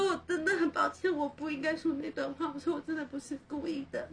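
really crying while she retells her apology; tearful apology. Real, not acted. Crying as she tells the cohost how she apologised. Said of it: "会哭欸，会哭欸。".

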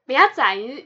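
laughing protest. Real, not acted. Protesting through laughter that her friend is poking fun, laughing. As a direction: laugh and protest being teased. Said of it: "始终就是那两个".

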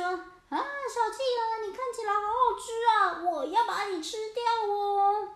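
high, loud, sing-song menace; hungry orca. Acted. Orca leaping at the little penguin, loud gleeful threat. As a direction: tease your prey gleefully.

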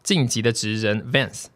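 upbeat sponsor ad read. Acted. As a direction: read it like a radio ad. Reading a sponsored ad.

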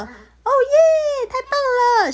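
高亢兴奋的欢呼; 开心得跳起来大叫. Acted. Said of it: "布布又跳又欢呼". (兴奋欢呼)

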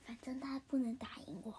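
whispered aside. Acted. Muttered aside.

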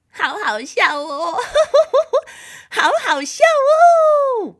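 high, loud giggling little sister; helpless mocking laughter; hysterical laughing. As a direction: shriek with acted laughter through the words. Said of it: "居然一直笑， 一直笑".

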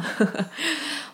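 short laugh. Real, not acted. Laugh after not replying.

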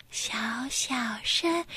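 soft slow whisper. Shown as a demonstration. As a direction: show how to whisper. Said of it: "像小鱼姐姐这样子".

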